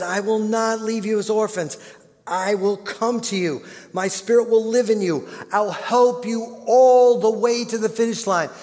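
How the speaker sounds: high, loud impassioned promise; fervent preaching. Acted. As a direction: lift it up as Jesus's own promise. Voices Jesus's promise with fervour.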